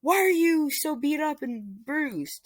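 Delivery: high distressed mother voice; worried mom. Retold or imitated. Mother Inko sees son's bruises, high distress. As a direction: fret over the bruises. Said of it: "And then Inko said".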